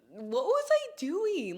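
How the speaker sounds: high, slow rhetorical question; drawn-out exasperation at her past self; exasperated disbelief. Real, not acted. Regretting paying for her own drinks, exasperated.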